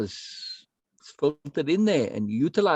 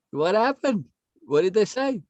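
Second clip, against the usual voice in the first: ordinary talk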